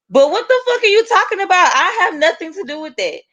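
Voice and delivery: very high-pitched indignant outburst; outraged denial. Retold or imitated. Retelling being blamed for the weed, outraged. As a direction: relive the protest, pitch shooting way up.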